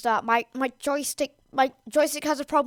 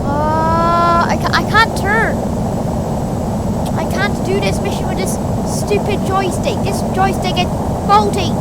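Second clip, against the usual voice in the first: loud, exasperated yelling at the joystick; frustrated shouting; 6 semitones higher, 6 dB louder than usual. Real, not acted. Joystick fails, can't turn plane.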